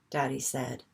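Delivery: plain reading voice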